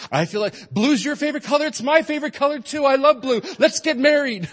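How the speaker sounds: put-on high, loud, gushing teen in love; lovestruck teenager. Pastor mimicking a teen couple's phone chat, gushing high. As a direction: gush like a smitten kid on the phone. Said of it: "you know the conversation goes".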